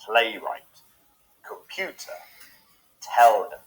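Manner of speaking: "Playwright", "computer", "telephone" slowly, leaning on PLAY, PU and TEL; stressed syllables punched. Shown as a demonstration. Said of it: "say the word slowly".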